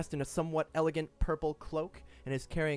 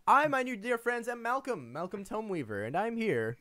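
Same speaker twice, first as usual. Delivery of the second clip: high, pompous self-introduction; Malcolm voice; 7 semitones higher than usual. Acted. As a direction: proclaim your name with flourish. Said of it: "I hate that you do that voice".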